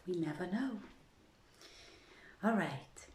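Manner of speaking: softly whispered "All right" to settle in; hushed "All right" before the tale. Real, not acted. Whispered aside before starting.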